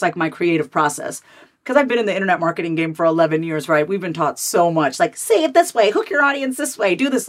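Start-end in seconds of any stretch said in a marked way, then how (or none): 5.1-7.2 high-pitched, mock bossy marketer; pushy mimicry. Creator mimicking the marketing advice she was taught, pushy and high. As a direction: parrot the marketing rules in a higher voice.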